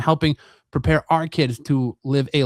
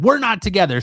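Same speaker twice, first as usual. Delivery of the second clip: mock scream; 6 semitones higher than usual. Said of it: "both scream at the same time".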